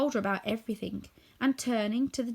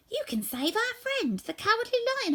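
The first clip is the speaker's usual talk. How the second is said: eager scarecrow; 13 semitones higher than usual. A narrator's reading. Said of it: "said quickly".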